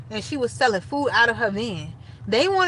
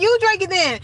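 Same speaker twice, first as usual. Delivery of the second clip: blunt retort; 10 semitones higher, 5 dB louder than usual. Retold or imitated. Retelling a blunt drunk comeback.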